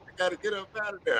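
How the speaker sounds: fast, hurried "I gotta get up out of there"; rushed escape. Retold or imitated. Heading back to work, fast and relieved. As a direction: rattle it off eager to flee. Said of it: "I'll be like".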